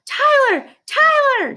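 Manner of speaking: high, loud call across the hill; shouting a name. A narrator's reading. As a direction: call him from far away, loud and high. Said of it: "she shouted".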